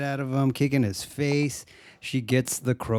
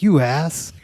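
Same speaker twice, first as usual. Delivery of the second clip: sudden higher, louder jab; mock angry insult; 8 semitones higher, 6 dB louder than usual. Woman cursing out the android, mock angry.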